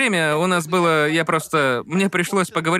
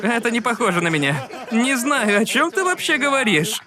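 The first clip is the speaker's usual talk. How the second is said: usual speaking voice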